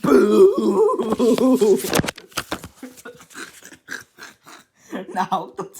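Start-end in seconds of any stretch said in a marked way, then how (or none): 0.0-1.8 huge yell, higher and much louder; shouted boo. Acted. Mister Noisy and Mister Jelly scare Little Miss Scary, huge boo. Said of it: "at the tops of their voices shouted". 1.8-4.6 laughter. Acted. Narrator laughs after big boo.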